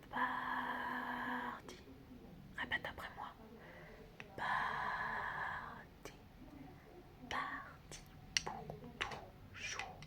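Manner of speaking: mock solemn whisper. Mock ritual about the ex.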